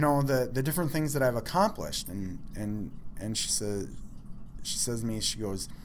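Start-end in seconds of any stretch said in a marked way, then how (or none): none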